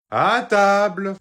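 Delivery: loud drawn-out call to the table. Acted. Dad calls the kids to dinner, loud and drawn out. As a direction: sing it out slow and loud to the whole house.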